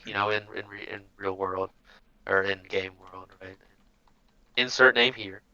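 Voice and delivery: ordinary delivery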